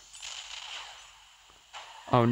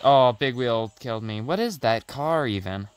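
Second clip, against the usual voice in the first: usual speaking voice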